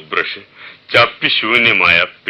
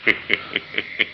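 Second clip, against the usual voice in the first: chuckle; 4 semitones higher, 7 dB quieter than usual. Acted. Watchman laughing at his nervous guest, eerie chuckle. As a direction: let out an uncontrolled little laugh. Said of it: "നിങ്ങൾ എന്താണ് ചിരിക്കുന്നത്".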